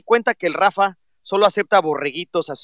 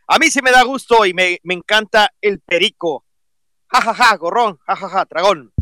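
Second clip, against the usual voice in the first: loud mocking read; 3 semitones higher, 7 dB louder than usual. Reading out a mocking tweet.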